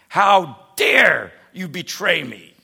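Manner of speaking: loud, slow, hammed-up yell of betrayal; mock angry scream. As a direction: stretch it out in a mock furious shout. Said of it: "screaming at me".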